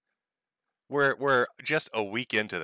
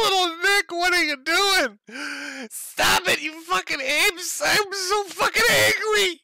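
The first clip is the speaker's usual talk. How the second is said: very high, loud, crying squeal of a furious little man; hammed-up high-pitched wail at the burger throwers; shrieking mock Nick; 5 dB louder, slower than usual. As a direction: shriek it like a toddler having a tantrum.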